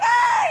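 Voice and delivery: sudden high laughing scream, half crying; reenacted scream. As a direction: shriek it out like the moment all over again, laughing through it. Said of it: "So I start screaming instantly".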